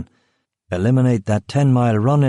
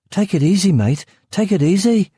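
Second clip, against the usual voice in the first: frightened Brummie guard; 10 semitones higher than usual. Acted. Brummie guard with a gun at his heart, frightened pleading. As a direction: beg him to stay calm at gunpoint.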